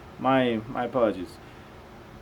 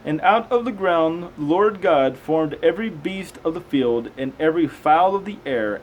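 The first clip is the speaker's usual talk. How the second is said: raised, slow Bible reading voice; slow, measured scripture reading; 5 semitones higher, 4 dB louder than usual. Acted. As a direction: read it out like a careful lesson.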